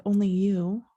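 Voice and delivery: ordinary delivery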